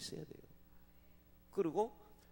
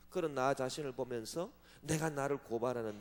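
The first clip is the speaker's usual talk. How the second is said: normal talking voice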